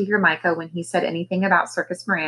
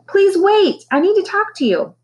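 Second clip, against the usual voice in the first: pleading call; 13 semitones higher, 5 dB louder than usual. A narrator's reading. Micah calls to bird.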